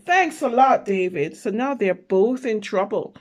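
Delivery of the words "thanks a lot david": louder sarcastic complaint from the big brother; annoyed brother. Acted. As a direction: blame him out loud. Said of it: "David's brother is saying".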